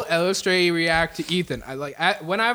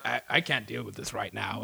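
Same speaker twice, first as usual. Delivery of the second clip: much lower, softer tired sigh of a line; a worn-out man done with the chaos; weary giving up; 12 semitones lower, 9 dB quieter than usual. Acted. Bone Master overwhelmed by the brawl, lower and softer in weary defeat. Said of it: "And Bone Master's like".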